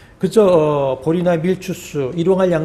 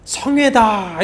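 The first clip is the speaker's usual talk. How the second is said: raised, drawn-out proclamation; proclaiming cry; 6 semitones higher than usual. Acted. Preacher shows the congregation how to cry out the assembly, proclaiming. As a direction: call it out high and slow. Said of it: "이렇게 부르짖으라라고 하는 것입니다".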